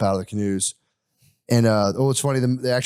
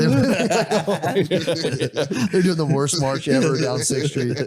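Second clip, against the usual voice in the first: said through real laughter, slow and higher; laughing; 6 semitones higher, slower than usual. Real, not acted. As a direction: chuckle the words out slowly. Laughing at a joke.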